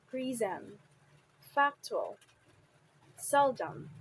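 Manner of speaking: PRIsm, FACtual, SELdom, each falling away after the first syllable; stress on first syllable. Shown as a demonstration. Teacher lists example words, stressing first syllables of prism, factual, seldom. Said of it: "receive the primary stress on the first syllable".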